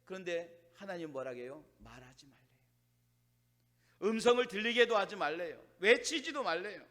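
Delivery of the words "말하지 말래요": very low, barely audible delivery; hushed. Real, not acted. Preacher relays God's command to keep silent, hushed. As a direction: drop right down to a hush.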